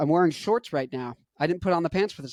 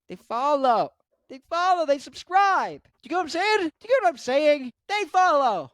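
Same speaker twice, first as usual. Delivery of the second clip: excited; 10 semitones higher, 3 dB louder than usual. Real, not acted. Hammering the payoff in rising excitement.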